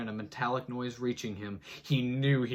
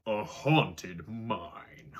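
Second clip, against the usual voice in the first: dramatic heading; much slower than usual. Acted.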